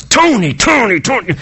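exasperated, chiding own name; mock frustration at himself over a work project; lightly acted sigh of self-reproach; frustrated self-scolding. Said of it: "when I get frustrated myself, I say my name".

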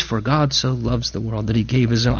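hushed and low, as if over a crib; soft scripture murmur. Retold or imitated. Father quoting scripture softly to his baby at bedtime, hushed and low. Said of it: "I would just be quoting scripture".

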